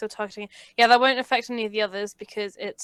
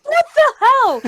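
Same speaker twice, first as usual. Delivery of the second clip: high, loud disbelieving shriek; outraged shriek; 12 dB louder, slower than usual. Real, not acted. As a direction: screech it in disbelief. Player outraged at failed roll.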